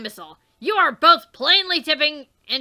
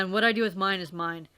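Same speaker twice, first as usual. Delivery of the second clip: usual speaking voice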